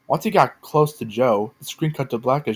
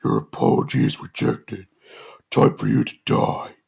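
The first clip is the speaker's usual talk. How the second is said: demonic voice. A narrator's reading. Masked figure threatening Joe in his shed, demonic voice. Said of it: "the figure replied".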